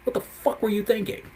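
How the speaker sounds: high, exasperated cry at the screen; frustrated outburst. Retold or imitated. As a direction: vent at Netflix, pitch shooting up. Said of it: "I was like".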